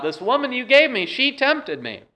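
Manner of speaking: high whining blame; complaining Adam. Retold or imitated. Adam complaining to God about the woman, high whine. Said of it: "He's kinda complaining".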